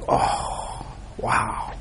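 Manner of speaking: hushed, breathless whisper of amazement; awed whisper. Retold or imitated. Teacher voices a student's awed realisation, whispered. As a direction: whisper it like a revelation just landed. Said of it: "And you're like".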